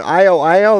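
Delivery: "I-O, I-O" called high and loud as two sounds, eye-oh. Shown as a demonstration. Showing viewers a greeting, "I-O, I-O" called out high and loud. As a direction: call out "I-O, I-O", each an eye then an oh. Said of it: "Instead of saying".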